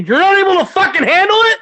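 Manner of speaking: furious high-pitched chewing-out; explosive, incredulous shouting; re-enacted yelling. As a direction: yell it as if reaming out a subordinate. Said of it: "it's about to get yelling".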